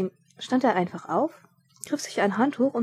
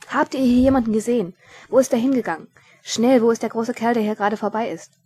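urgent; 3 semitones higher, 6 dB louder than usual. Acted. Frantic questioning in the chase.

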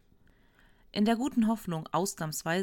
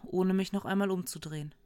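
ordinary delivery, nothing marked

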